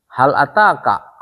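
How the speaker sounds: Hal ataka read slowly, each syllable given weight; slow Arabic reading. Shown as a demonstration. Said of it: "apakah sudah datang kepadamu?".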